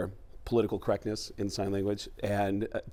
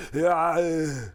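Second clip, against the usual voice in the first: lame impression; 4 semitones higher than usual. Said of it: "get away with something really lame".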